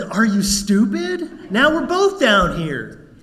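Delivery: loud, incredulous scolding of a friend; exasperated. Acted. Trapped man scolds friend who jumped.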